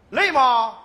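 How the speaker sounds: loud mock-sweet boss asking; fake-caring boss. Second boss feigns concern, loudly and sweetly. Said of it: "又假惺惺地问我".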